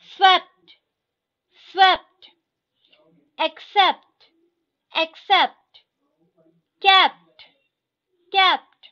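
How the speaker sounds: each word said twice, loud and crisp; teacher drilling vocabulary, clear and loud; word drill reading. Shown as a demonstration. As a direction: read the list aloud for the class to repeat. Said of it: "मैं line by line ऐसे पढ़ते जाऊंगी".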